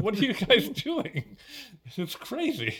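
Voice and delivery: high, incredulous laugh through the words; laughing disbelief. Real, not acted. Baffled viewer reacting to the movie, laughing in disbelief.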